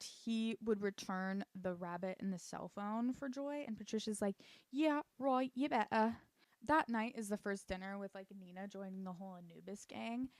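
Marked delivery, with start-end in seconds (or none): 4.7-6.2 put-on loud, scoffing British accent. Patricia doubting Victor's promise, scoffing British accent. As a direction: scoff it loudly in mock British. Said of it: "I keep doing that accent".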